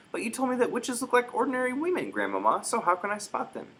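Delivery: put-on high, earnest young boy's voice; a doubting little boy asking his grandmother; small boy pleading.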